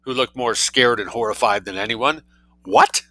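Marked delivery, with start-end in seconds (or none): 2.6-3.0 high, loud outraged burst; explosive, incredulous yelp; shout. A narrator's reading. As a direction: shout it in disbelief. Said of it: "shouted Colonel Hampton".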